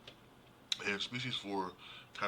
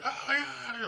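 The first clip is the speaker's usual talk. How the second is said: high, loud mock shriek with a laugh; a hammed-up freak-out; mock alarm; 7 dB louder, slower than usual. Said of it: "the guy's like".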